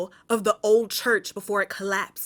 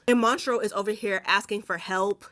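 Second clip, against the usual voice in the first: normal talking voice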